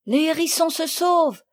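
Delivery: high, loud alarmed cry. Acted. Marguerite crying out as the hedgehogs flee. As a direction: shout the alarm as they escape. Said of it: "s'écria Marguerite".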